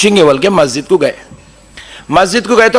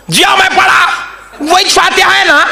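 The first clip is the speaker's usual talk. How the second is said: laughing shout; 13 semitones higher than usual. Retold or imitated. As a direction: blurt it out laughing, caught out. Cleric's flustered reply, laughing.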